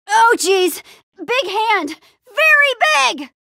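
high, startled yelling; alarmed cry. Acted. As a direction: panic rising to a drawn-out shout. Startled by a giant hand.

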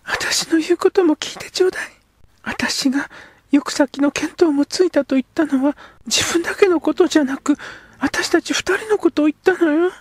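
put-on high earnest girl's voice; a girl insisting he hear her out; young girl. Girl character speaks.

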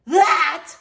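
loud high shocked roar. A narrator's reading. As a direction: roar it in disbelief. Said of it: "roared Horace".

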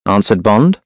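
ordinary delivery, nothing marked

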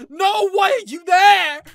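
dismayed shout. Real, not acted.

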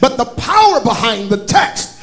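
loud, rising preacher's call over music; full-force preaching. Acted. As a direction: drive it home to the congregation. Preaching builds again to shouting.

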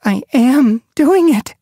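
high, loud, slow; triumphant. A narrator's reading. Leopard Paw realizes she swims, high triumphant. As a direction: savor the triumph.

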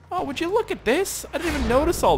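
high, wondering exclamation; surprised discovery. Real, not acted. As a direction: start high-pitched in amazement, then settle.

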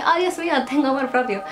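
high mock surprised gasp; mock revelation. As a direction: play a sudden epiphany, high. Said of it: "me levanté y dije". (revelación fingida, mucho más aguda)

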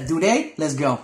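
usual speaking voice

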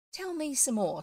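a touch of eager excitement; excited. A narrator's reading. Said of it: "all excited".

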